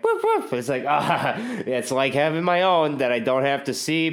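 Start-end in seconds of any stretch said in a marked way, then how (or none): 0.0-0.5 high yappy woof woof; imitated dog barks. Imitating the neighbor's dog barking through the wall, high yaps. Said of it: "it's just like".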